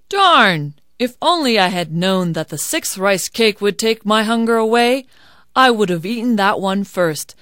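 loud, mildly vexed character voice; exasperated hungry man. Acted. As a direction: kick yourself out loud. Hungry man regrets his choice.

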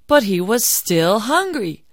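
ordinary delivery, nothing marked

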